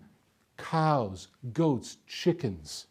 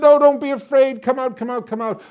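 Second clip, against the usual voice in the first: high, eager coaxing call; calling out reassurance; 10 semitones higher, 10 dB louder than usual. A narrator's reading. Soldier urging peeking villagers outside, calling high. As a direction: coax shy people out from afar.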